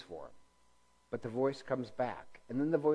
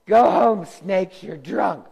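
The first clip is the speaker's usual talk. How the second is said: much higher, loud mock yell at the snakes; mock scolding shout; 7 semitones higher, 15 dB louder, slower than usual. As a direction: bellow it like a fed-up bouncer, hamming it up. Reading a joke meme aloud.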